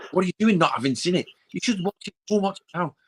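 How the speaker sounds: high-pitched pushy friend impression; mock aggressive insistence. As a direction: imitate someone hectoring you, pitched right up. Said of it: "they start getting aggressive with it".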